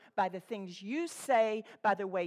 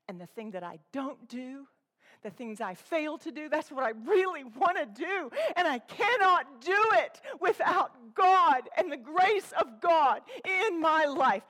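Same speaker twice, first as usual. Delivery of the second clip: building to fervent; 3 semitones higher, 3 dB louder than usual. Shown as a demonstration. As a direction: build from the hush into fervent need for grace. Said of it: "That is what a teacher who's being vulnerable sounds like".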